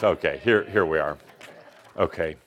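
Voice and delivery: usual speaking voice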